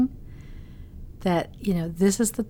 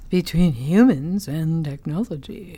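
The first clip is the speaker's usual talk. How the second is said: imitating announcer voice; 2 semitones lower, 4 dB louder than usual. When you said.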